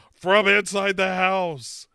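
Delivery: loud, high mock-horror announcement; dramatic horror reveal. Telling the call's twist, in a loud mock-horror cry. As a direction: belt out the big scary twist.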